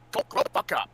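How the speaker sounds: usual speaking voice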